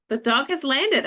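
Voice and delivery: high, bright-eyed announcing; excited announcement. Retold or imitated. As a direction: announce it like big news.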